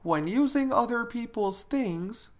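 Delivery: high-pitched mother leading a recitation; mother prompting. Acted. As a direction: start the saying for a child to finish, pitched high. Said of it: "her mother just said".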